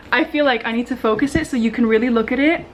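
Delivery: normal talking voice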